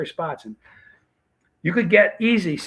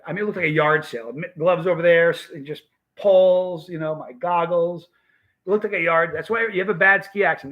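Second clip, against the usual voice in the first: normal talking voice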